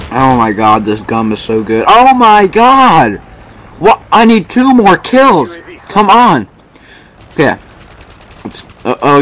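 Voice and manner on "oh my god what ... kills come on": higher, drawn-out excited yelling; shouting at the screen in excitement. Real, not acted. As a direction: urge the game on, almost there. Close to the nuke, excited.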